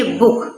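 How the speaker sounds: बुक with a short, clipped oo; short oo in book. Shown as a demonstration. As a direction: say बुक quickly, short vowel. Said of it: "तो ये जल्दी से बोलती है".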